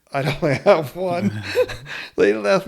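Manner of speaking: laughing through the words; can't hold back a laugh at his own luck; laughing as he admits he has no boarding pass. Real, not acted. Caught without a boarding pass.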